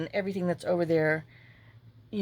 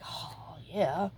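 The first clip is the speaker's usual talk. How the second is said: whispered aside; 6 dB quieter than usual. Retold or imitated. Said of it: "I'm just like".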